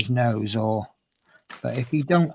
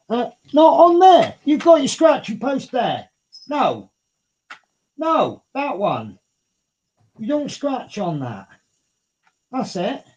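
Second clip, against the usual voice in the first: high, loud scolding of a pet; sharp high voice to stop the kitten; telling the cat off; 12 semitones higher, 6 dB louder than usual. Real, not acted.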